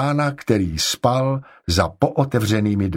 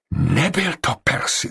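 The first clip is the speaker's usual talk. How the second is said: higher-pitched, mildly frightened voice; vyděšeně; 6 semitones higher than usual. A narrator's reading. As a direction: report it with a touch of shock. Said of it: "vyděšenou tváří". (trochu vyděšeně)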